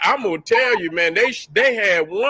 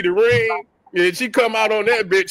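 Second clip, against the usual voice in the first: usual speaking voice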